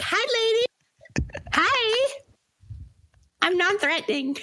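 chirpy non-threatening voice for women; high girly voice. Retold or imitated. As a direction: voice jumps way up, sweet and light. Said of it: "you talk to women and you're like".

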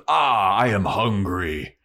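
slow, declaiming troll voice. Retold or imitated. Hungry troll asking Spike, slow declaiming troll voice. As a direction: ask it as a big troll, drawing out the words. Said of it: "the troll goes up to Spike".

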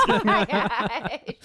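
drawn out through real laughter; cracking up, slow on the words; laughing disbelief. Real, not acted. Cracks up at height joke.

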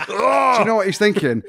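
high and loud through laughter; bursting out laughing mid-question. Real, not acted. Laughing while telling the story.

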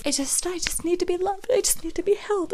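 high soft lisping plea; lispy needy voice. Retold or imitated. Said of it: "This weird, lispy sort of".

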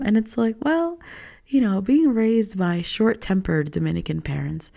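normal talking voice

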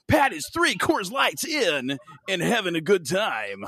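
radio host voice. Acted. Radio host bit continues.

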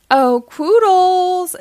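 high, loud, slow sing-song call. A narrator's reading. Fairy godmother summoning Quoodles, sing-song call. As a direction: call for the helper sweetly. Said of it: "she calls".

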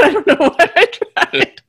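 high, loud laughing speech; real laughter through the words. Real, not acted. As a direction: crack up at your own failed attempt. Cracks up at own failed accent.

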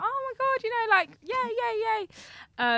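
high-pitched eager agreeing; imitating sweet friend. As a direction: mimic a lovely, over-agreeable girl in high pitch. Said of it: "a very lovely girl".

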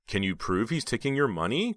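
ordinary delivery

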